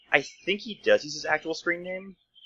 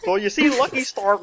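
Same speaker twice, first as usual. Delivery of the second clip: mock nerd voice; 10 semitones higher, 7 dB louder than usual. Mocking the anime fan.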